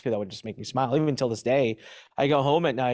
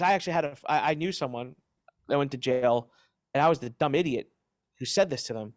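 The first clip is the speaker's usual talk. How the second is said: usual speaking voice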